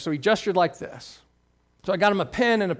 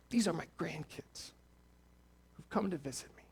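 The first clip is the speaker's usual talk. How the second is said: trembling pride; 12 dB quieter than usual. Retold or imitated. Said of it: "And he started to tremble".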